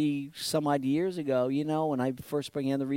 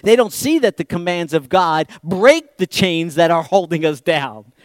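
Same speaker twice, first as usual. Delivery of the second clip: ordinary delivery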